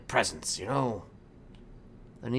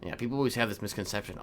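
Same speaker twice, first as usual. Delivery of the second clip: usual speaking voice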